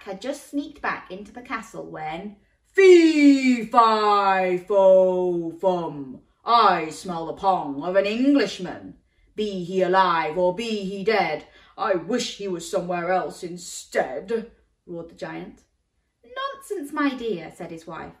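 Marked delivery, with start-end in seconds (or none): 2.7-14.5 big, loud giant voice chanting; booming giant. A narrator's reading. Giant roaring his chant at Jack's scent. 16.2-17.5 giant's wife. A narrator's reading. Wife voiced.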